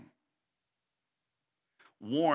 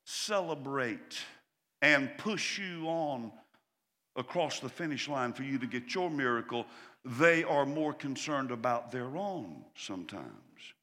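ordinary delivery

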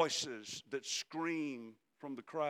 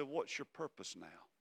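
much softer, hushed question; gentle; 3 semitones lower, 11 dB quieter than usual. Acted. God softly asking Elijah his purpose, hushed. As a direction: ask it quietly like a close friend. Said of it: "the gentle whisper finally got to him".

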